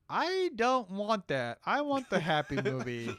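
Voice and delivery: high, loud, drawn-out complaint; mock protest. Fan rejecting a dysfunctional family movie, mock whining. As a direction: protest slowly like an upset child, played up.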